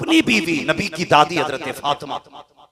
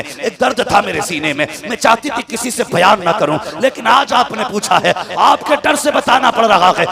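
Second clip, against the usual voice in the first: higher and louder, building to a cry; rising sermon climax; 8 semitones higher, 8 dB louder than usual. Acted. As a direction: build the preaching to a crescendo. (ऊँचे सुर में चढ़ता हुआ जोशीला बयान)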